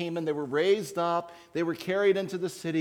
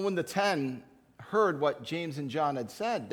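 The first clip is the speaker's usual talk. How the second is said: ordinary talk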